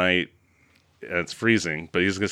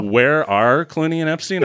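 ordinary delivery

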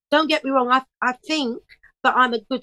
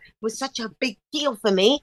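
ordinary delivery

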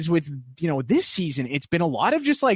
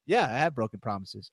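calm reply; 5 semitones lower, 6 dB quieter than usual. Retold or imitated. Calm Kevin answering the accusation.

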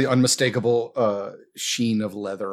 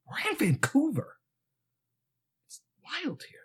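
whisper; 13 semitones higher, 7 dB quieter than usual. Acted. GM whispering warning aside.

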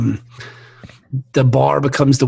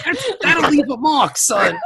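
laughing voice; 11 semitones higher, slower than usual. Acted. Mock cowboy reply, laughing.

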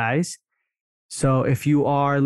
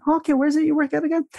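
much higher, quick, innocent question; casual ask; 14 semitones higher, much faster than usual. Shown as a demonstration. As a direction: sound like you never saw the file. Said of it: "I'm still gonna ask that question".

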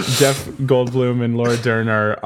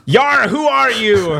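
high, slow hammed-up pirate yar; 12 semitones higher, 5 dB louder than usual. Storyteller voicing Stephen Lang's pirate, hammed-up yar. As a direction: bellow it like a cartoon pirate. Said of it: "he's like".

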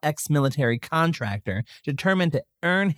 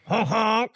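silly clown; 6 semitones higher than usual. Pretend. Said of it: "Is that what trains mean?".

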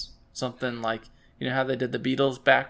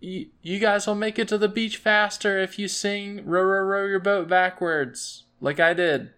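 higher-pitched yell across the water; character voice; 7 semitones higher than usual. Acted. Yelling to the others in the rowboat, in character voice. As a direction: call over in character, pitched up. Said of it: "trying to put it into character voice".

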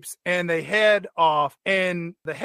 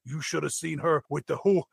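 princess's hammy dad; 2 semitones higher than usual. Acted. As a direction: gush like an overemotional dad at a wedding. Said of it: "Turns out it's the princess's dad".

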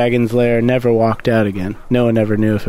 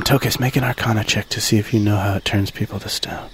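softer, secretive whisper; 4 semitones lower, 6 dB quieter than usual. Acted. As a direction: lean in and whisper the request. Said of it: "I whisper to him".

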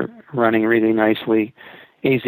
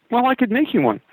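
much higher, boyish imitation of the kid; young kid voice; 13 semitones higher than usual. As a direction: voice the eager computer kid. Young kid voiced in retold story.

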